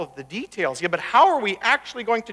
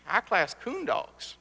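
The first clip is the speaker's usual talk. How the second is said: savoring repeat; 4 dB quieter, slower than usual. Shown as a demonstration. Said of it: "give you a little flavor".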